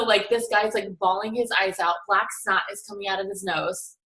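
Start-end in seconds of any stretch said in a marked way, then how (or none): none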